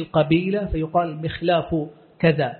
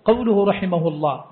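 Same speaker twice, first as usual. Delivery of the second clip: ordinary delivery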